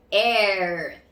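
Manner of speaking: "er" with a long growled R; drawn-out final R. Shown as a demonstration. Teacher joining E and R, drawn-out R. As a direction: hold the R at the end of "er".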